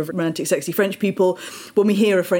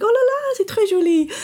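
delighted admiration. Acted. Admiring a friend's new engagement ring, delighted. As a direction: coo in a much higher pitch, thrilled. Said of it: "in a more positive context".

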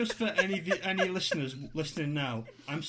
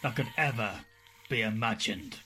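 spooky story narration; 6 semitones lower than usual. Acted. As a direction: tell it like a creepy campfire tale. Spooky story intro over music.

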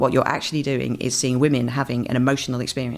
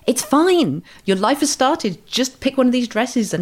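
mock reassuring; 10 semitones higher, slower than usual. Kind woman reassures bride-to-be.

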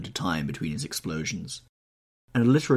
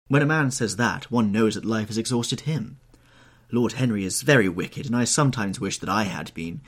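higher, louder society matron; Lady Narborough voice; 3 semitones higher, 4 dB louder than usual. Acted. Old hostess fussing over Dorian, higher and louder.

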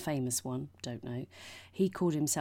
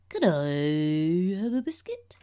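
slow, louder, oddly stretched question; weird drawn-out delivery; 3 semitones higher, 7 dB louder, slower than usual. Retold or imitated. Imitating the friend's bizarre take during filming. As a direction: drag the whole question out strangely. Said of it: "for no reason, he just went".

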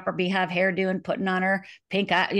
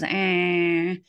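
imitated car horn. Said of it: "all I heard was".

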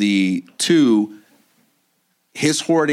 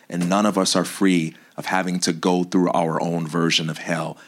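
ordinary delivery

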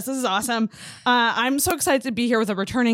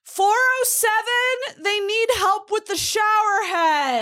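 high, drawn-out call to a coworker; mock calling out; 12 semitones higher, slower than usual. Front desk announces a guest's shower trouble, mock calling out. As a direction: call it across in a high, stretched voice. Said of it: "could have just yelled".

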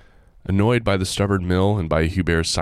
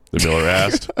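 ordinary delivery

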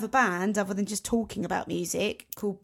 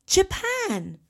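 excited; 11 semitones higher, 4 dB louder than usual. Real, not acted. Map lighting up, excited.

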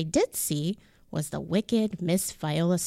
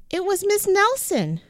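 ordinary delivery, nothing marked

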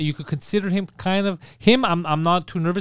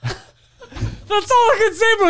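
laughing quote; 4 dB louder, much slower than usual. Retold or imitated. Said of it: "he looks at my mom and goes".

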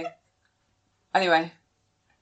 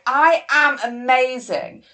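slow, loud, higher mock grandiosity; tongue-in-cheek boast; 5 semitones higher, 6 dB louder, slower than usual. Showing co-host the social media caricature, tongue-in-cheek bragging. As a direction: play a caricature of self-love, drawing it out. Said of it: "caricature of myself that's very tongue-in-cheek".

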